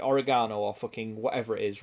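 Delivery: ordinary talk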